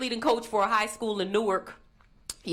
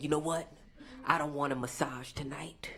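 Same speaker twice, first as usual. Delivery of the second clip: creepy client whisper; 8 semitones lower, 8 dB quieter than usual. Retold or imitated. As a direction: whisper the strange request like a creepy customer. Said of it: "he's like".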